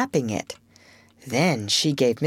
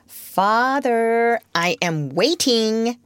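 put-on slow, higher, coldly expectant boy; 6 semitones higher, much slower than usual. A narrator's reading. Michael waiting on his father, coldly. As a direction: draw it out with cool impatience. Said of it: "said Michael coldly".

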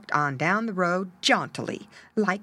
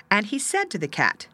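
ordinary delivery

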